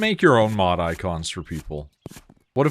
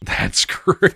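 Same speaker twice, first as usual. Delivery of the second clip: laughing; 10 semitones higher, 4 dB louder than usual. Real, not acted. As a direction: laugh mid-word, high. Reacting to funny hiccup story.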